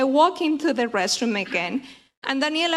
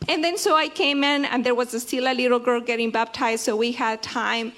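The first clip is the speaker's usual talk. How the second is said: ordinary talk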